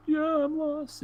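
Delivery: very high imitated child; little kid voice. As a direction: squeak it like a small child. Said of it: "the kid was like".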